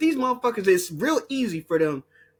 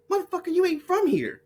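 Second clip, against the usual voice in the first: mock retort; 11 semitones higher than usual. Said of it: "Chinese people gonna be like".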